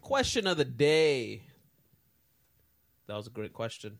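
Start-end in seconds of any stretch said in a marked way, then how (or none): 0.0-1.4 announcer. Acted. Announcing segment.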